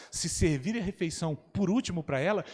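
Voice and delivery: normal talking voice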